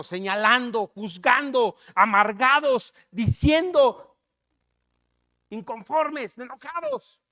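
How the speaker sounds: loud, hammered list of faults; forceful denunciation. Real, not acted. Preacher rebukes the congregation for criticizing, forceful denunciation.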